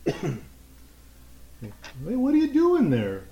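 high, put-on kid voice; loud little kid questioning a stranger; child's voice. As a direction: pitch it up like a child calling out. Game master voices approaching child.

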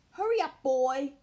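loud high shout up the stairs. A narrator's reading. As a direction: yell it, impatient uncle. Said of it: "shouted Uncle Her".

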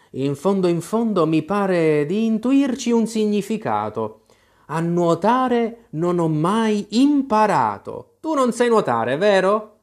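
a pondering king thinking aloud; king puzzling over the verse; lightly voiced king.

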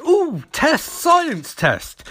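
high, eager ooh, then dropping; hammed-up delight at an experiment; mock scientist excitement. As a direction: swoop up high with geeky glee. Voicing Caitlin keen to experiment.

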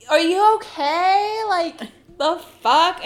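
loud, high, drawn-out disbelief; exasperated retort. Retold or imitated. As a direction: retell it as an incredulous challenge. Retold annoyance at his breathing.

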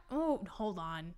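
talking through a burp; words over a burp. Real, not acted. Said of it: "That was a burp again".

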